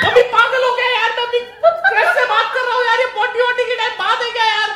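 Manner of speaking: loud, high exasperated shout; acted outraged son shouting at mom; indignant denial. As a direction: yell back in embarrassed protest. Embarrassed son yells at mother.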